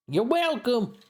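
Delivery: put-on high Southern girl voice; sweet Southern reply; Southern belle. Southern clerk replies.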